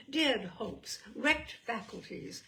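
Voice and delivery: ordinary delivery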